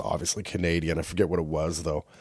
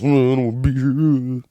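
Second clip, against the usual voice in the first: mock bored kid; 4 semitones higher, 4 dB louder than usual. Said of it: "humming and hawing".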